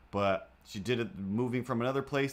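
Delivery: ordinary talk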